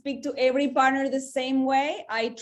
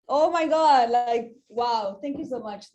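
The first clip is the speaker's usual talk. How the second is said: ordinary talk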